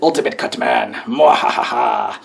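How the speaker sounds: low, slow evil villain laugh; sneering panto villain. Pretend. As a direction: gloat like a cartoon baddie.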